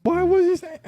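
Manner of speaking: high, laughing mom scolding. Retold or imitated. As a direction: play your mom catching you out. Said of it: "she gonna call me".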